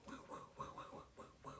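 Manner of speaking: very soft, voiceless woo-woo noises; whispered mock-mystical wooing; imitated woos. Reader mimics magic objects flying into her hands, soft woos.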